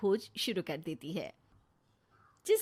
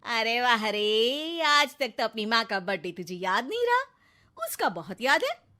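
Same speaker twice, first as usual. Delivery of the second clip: taunting mother; 7 dB louder than usual. Acted. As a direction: scold the son with mock praise. Neha taunts her son. (ज़ोर से ताना)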